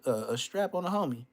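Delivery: ordinary delivery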